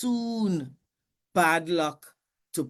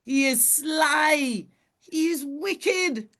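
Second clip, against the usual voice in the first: angry animals; 9 semitones higher, 3 dB louder than usual. Acted. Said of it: "they said".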